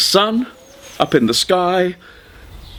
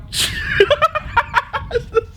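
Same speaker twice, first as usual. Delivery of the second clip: very high giggle; girlish giggle. Acted. Girl explains the bat to her father, high giggle. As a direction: break off into a helpless giggle. Girl laughing mid-sentence.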